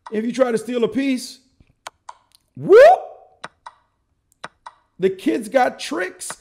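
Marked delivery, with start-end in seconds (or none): 2.5-3.5 a sudden, much higher, louder whoop; excited whoop. Acted. Commentator showing the kid's trick, sudden loud whoop. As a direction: yelp it like a trap snapping shut. Mimics tactic springing.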